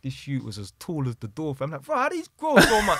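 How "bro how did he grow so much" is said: high, loud mock amazement; astonished. Storyteller seeing how tall the youth is, acted loud astonishment. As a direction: blurt it out in comic disbelief.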